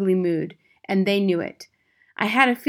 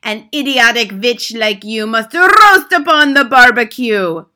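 put-on loud, higher accented curse with rolled R; Grand High Witch reciting; 5 semitones higher, 13 dB louder than usual. A narrator's reading. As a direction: declaim the terrible sentence. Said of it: "continued with her terrible recital".

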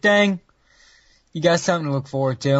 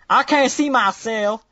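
slow, higher Southern American drawl; southern accent; 7 semitones higher, slower than usual. Acted. Host accidentally slips into a Southern accent. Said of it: "random super southern accent".

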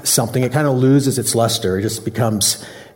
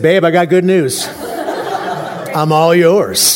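mock grand announcement; 4 semitones higher than usual. Pastor to his wife, hammed-up gracious announcement. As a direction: proclaim it like a gift to her, slow on the end. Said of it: "And finally I said".

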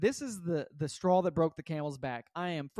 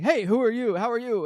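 loud, higher-pitched confronting neighbor; suspicious challenge; 6 semitones higher, 10 dB louder than usual. Retold or imitated. Neighbor challenging a suspected package thief, loud suspicious challenge. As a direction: call out sharply at a stranger.

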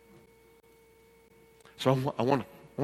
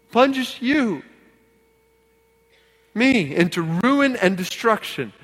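higher, forceful pulpit delivery; emphatic preaching; 10 semitones higher, 9 dB louder than usual. Real, not acted. Pastor warns those tied up in riches, emphatic preaching. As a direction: press the warning on every listener.

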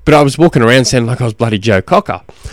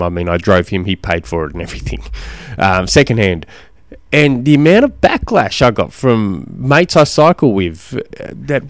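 ordinary delivery

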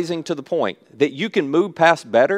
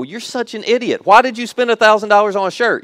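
higher-pitched playful disbelief; mock scolding; 6 semitones higher than usual. Speaker acts out reacting to a friend's pricey shirt, mock scolding. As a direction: tell off a friend in mock outrage.

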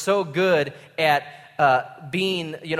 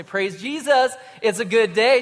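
higher, louder mock-joyful exclamation; fake cheer; 6 semitones higher, 3 dB louder than usual. Preacher pretending all is great after losing his job, falsely cheerful. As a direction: overdo the cheer to cover a bad day.

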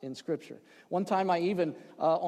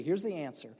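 usual speaking voice

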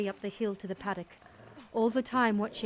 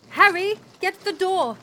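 higher, much louder urgent call; urgent shout; 10 semitones higher, 12 dB louder than usual. A narrator's reading. Mother to Harry as the dingo climbs in, urgent shout. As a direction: shout an order in alarm.

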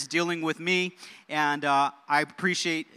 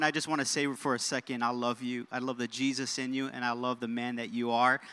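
softer, lower aside, tender and steady; sincere affection; 7 semitones lower, 7 dB quieter than usual. Real, not acted. Pastor to his co-preacher Martin, sincere and soft. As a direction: drop low and quiet to tell him you love him.

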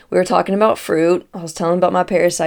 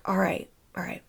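whisper; 2 semitones higher, 9 dB quieter than usual. Retold or imitated. Man giving in.

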